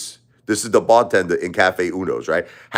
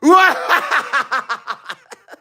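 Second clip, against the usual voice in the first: Eddie Murphy laugh. Retold or imitated. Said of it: "he laughs like Eddie Murphy".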